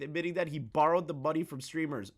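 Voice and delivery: usual speaking voice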